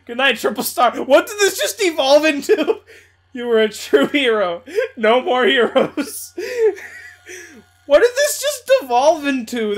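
loud, high laughing outburst; can't believe it, cracking up; incredulous laughter. Real, not acted. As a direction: laugh through it, loudly baffled. Laughing at absurd game turn.

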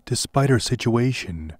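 ordinary delivery

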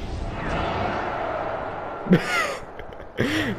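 a high, breathless burst of laughter. Real, not acted. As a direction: cackle at the kill in disbelief. Reacting to a game kill.

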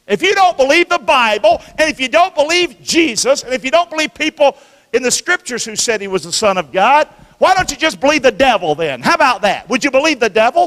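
high, loud, insistent pulpit voice; full-force preaching. Acted. Preacher challenging the congregation to believe, loud and high. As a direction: hammer each "don't" home.

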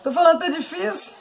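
laughing. Real, not acted. Laughs at herself mid-talk.

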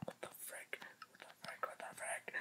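hushed, breathless what-the-frick; shocked whisper. Real, not acted. Gamer startled by a mob, whispering. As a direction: whisper it in disbelief.

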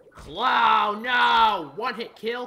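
loud, high cry of protest; outraged gamer yell; frustrated shout. Real, not acted. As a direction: shout in disbelief at dying. Killed in one hit.

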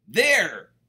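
loud high triumphant shout; a greedy pug's victory shout. Acted. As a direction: bellow it from the top of the pile. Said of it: "shouted Pig".

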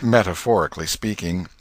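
ordinary delivery

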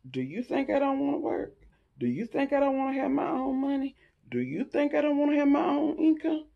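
rising indignant questions. Real, not acted. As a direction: push back, getting heated.

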